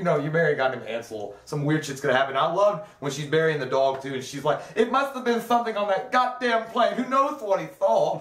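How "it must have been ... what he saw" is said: imitating the wife. Wife quoted burying the dog.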